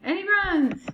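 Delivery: high, loud chase narration; excited. A narrator's reading.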